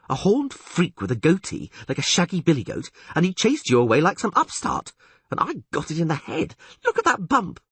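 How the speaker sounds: high-pitched indignant complaint; aggrieved Dandelion. Acted. Dandelion moans to Geralt about his bump, aggrieved. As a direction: complain like a wronged companion.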